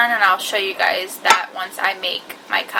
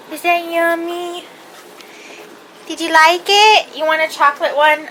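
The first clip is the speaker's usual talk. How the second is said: dog voice; 4 semitones higher than usual. Real, not acted. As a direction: coo and cheer as if talking to your dogs. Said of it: "Sorry for my annoying dog voice".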